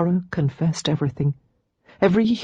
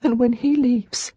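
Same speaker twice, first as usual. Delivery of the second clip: tearful; 7 semitones higher than usual. Acted. Lara sobbing confession.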